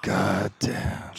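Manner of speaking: breathy whispered curse. Real, not acted. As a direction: exhale the words in a whisper. Whispered after a hit.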